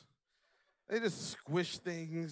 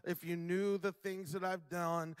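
usual speaking voice

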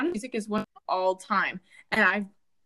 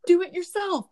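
higher laughing mock retort; 9 semitones higher than usual. Family laughing back at the demanding dad. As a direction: laugh through the comeback. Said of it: "we're just like".

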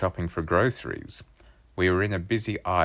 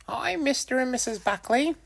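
put-on much higher polite kid voice; Wolfie's voice. A narrator's reading. Wolfie greeting his friend's parents, much higher voice.

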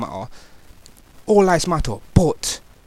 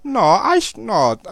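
retold angry protest; 9 semitones higher, 4 dB louder, much slower than usual. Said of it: "I'm like".

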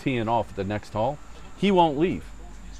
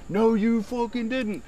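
high, drawn-out retort; mock yelling back; 11 semitones higher, slower than usual. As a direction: mimic an indignant holler, slow and pitched up. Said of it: "the guys are yelling back".

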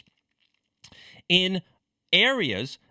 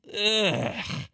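disgusted groan; 4 semitones higher than usual. Real, not acted. Disgust after reading quote.

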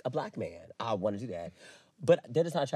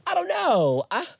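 mock disbelief; 13 semitones higher, 3 dB louder than usual. Real, not acted. Playful mock disbelief, high voice.

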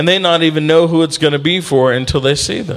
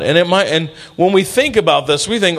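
usual speaking voice

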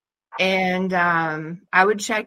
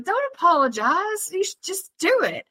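impatient urging; 10 semitones higher than usual. Retold or imitated. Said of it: "I was like".

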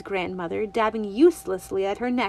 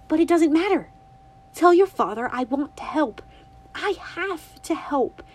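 put-on eager young girl, pitched higher; a bright little girl asking her granny; girlish and eager, lightly voiced; Winnie's voice; 7 semitones higher, 5 dB louder than usual. A narrator's reading. Winnie determined.

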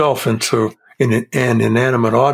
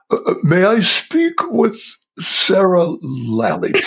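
higher, hesitant regal puppet voice; King Friday voice; 6 semitones higher than usual. Retold or imitated. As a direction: ease into a lightly played puppet king. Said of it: "King Friday called you".

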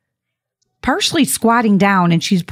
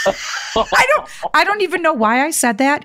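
real laughter; 7 semitones higher than usual. Real, not acted. Laughing at herself while telling the host, real. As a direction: talk through the laugh, pitch up.

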